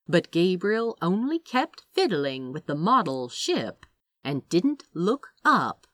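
ordinary delivery

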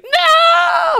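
very high, long cartoon scream; SpongeBob. SpongeBob seeing the last bus gone, long shrieked cry. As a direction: wail it out loud and high. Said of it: "SpongeBob's just like".

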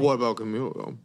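low talk-show host impression; Wendy Williams voice. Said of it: "That was my Wendy Williams voice".

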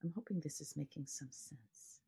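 whispered aside, barely voiced; soft whisper. Real, not acted. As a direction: breathe it out as a quiet check-in. Aside to meditation listeners.

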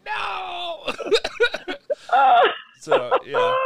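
high squealed no through laughter; denying the fart with a squeal; laughing shout. Retold or imitated. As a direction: yelp it out, cracking up. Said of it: "And I'm like".

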